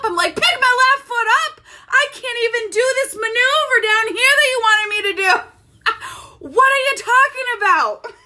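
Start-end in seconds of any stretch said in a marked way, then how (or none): 0.4-5.3 high, wailing mock outrage; incredulous protest. Student protesting the teacher's impossible maneuver, incredulous. Outraged reply to instructor's order. 6.5-8.1 high, exasperated cry through laughter; laughing disbelief. Retold or imitated. Student giving up on the teacher's instructions, laughing in disbelief.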